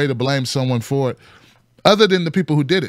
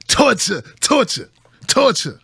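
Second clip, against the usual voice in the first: each torture rising higher; echoing the Wu-Tang torture scene; 4 semitones higher, slower than usual. Retold or imitated. Playing the Wu-Tang record scene, mock chant. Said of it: "play that scene where they talk about torture".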